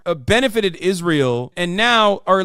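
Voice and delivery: ordinary talk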